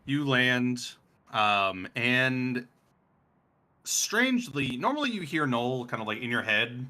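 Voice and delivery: ordinary delivery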